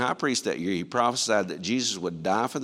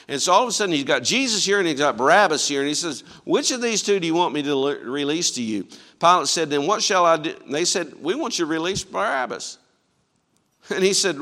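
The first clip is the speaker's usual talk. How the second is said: loud, high, driving sermon delivery; preaching at full force; 6 semitones higher, 7 dB louder than usual. Acted. Preacher retelling Pilate's trial to the congregation, loud and driving. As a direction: push the story hard toward the crowd.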